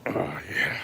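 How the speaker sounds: soft whisper to the dog. Real, not acted. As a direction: breathe it gently.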